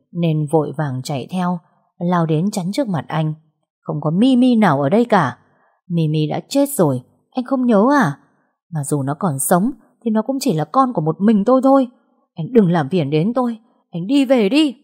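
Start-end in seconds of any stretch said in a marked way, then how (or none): 3.8-14.8 angry refusal. Acted. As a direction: defend the child, send him away. Mother blocks ex, quarrel.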